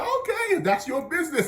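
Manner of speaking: high-pitched mock concession; teasing dismissal. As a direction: back off with a teasing, high lilt. Retold teasing dismissal of student.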